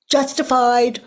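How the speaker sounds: loud indignant ego voice; voicing the ego. Teacher voicing her own ego's defensive claim. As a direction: let the ego blurt it out. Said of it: "Thank you for sharing, ego".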